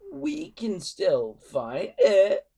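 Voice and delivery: a desperate villain begging; urgent. A narrator's reading. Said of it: "said Alvin urgently".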